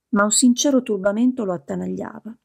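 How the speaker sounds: ordinary delivery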